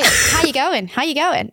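lightly put-on Australian accent; Australian phrasing. Shown as a demonstration. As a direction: imitate an Australian saying hello. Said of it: "what did they say?".

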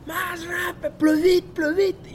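grita más agudo, imitando a los entrenadores franceses; gritos de entrenadores. Retold or imitated. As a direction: urge a gritos que vaya más rápido. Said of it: "me empiezan a gritar". (imitando gritos de entrenadores)

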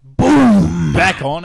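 loud burst of energy; excited shout. Real, not acted. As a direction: shout it like a big return. Announcing podcast is back.

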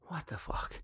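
breathless unvoiced whisper of disbelief; stunned whisper. Husband hearing of a huge order, stunned whisper. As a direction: whisper it in shock. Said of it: "I'm like".

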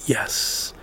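very soft, breath-only yes; perfect little yes. Retold or imitated. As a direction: whisper it, drawn out. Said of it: "her yes was so perfect".

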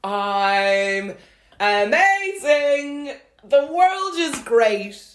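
giddy high. Retold or imitated. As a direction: imitate a friend floating on a high. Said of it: "like, in a hot air balloon".

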